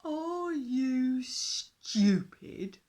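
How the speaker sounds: loud, very slow, booming dinosaur; grumpy dinosaur. A narrator's reading. Dinosaur sneers at the fish king, loud and slow. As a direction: drag it out like a scornful giant beast.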